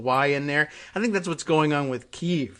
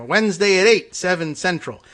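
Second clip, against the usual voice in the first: raised promo announcer voice; 5 semitones higher, 8 dB louder than usual. Retold or imitated. Host recalling old TV promos, mock announcer. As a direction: punch it like a lineup announcement. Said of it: "when they used to say".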